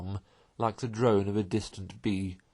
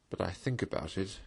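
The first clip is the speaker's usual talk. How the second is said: soft and sorry for himself; quiet, wistful confession; pathetic, lower tone; 3 semitones lower, 7 dB quieter than usual. A narrator's reading. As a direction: let it sink low and wistful. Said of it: "pathetically in a lower tone".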